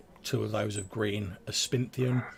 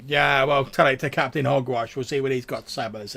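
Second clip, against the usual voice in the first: louder, brusque guard voice; gruff guard; 4 semitones higher, 8 dB louder, faster than usual. Acted. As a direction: shove the words at him. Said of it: "And he's like".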